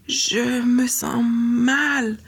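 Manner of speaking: put-on slow, faint sick girl; a lightly voiced ailing girl. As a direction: drag the words out, feverish. Feverish girl speaks weakly.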